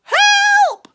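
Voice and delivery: very high, loud scream for help; panicked scream. A narrator's reading. Rabbit fleeing the noises, shrill panicked scream. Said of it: "screamed Rabbit".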